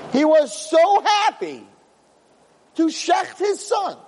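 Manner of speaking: high, slow fervent preaching. Acted. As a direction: build the awe of the story. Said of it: "preaching the sacrifice".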